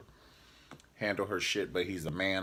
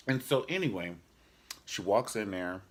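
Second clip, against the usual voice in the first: ordinary delivery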